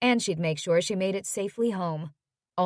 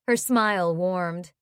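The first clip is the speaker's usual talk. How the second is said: ordinary delivery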